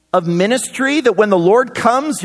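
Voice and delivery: ordinary talk, nothing marked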